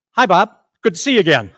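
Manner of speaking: punchline in a lightly voiced parrot voice; joke parrot. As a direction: greet him like an old acquaintance, as the parrot. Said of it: "Parrot looks at him, says".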